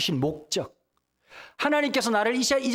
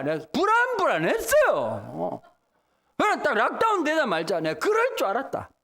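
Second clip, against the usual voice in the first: ordinary talk, nothing marked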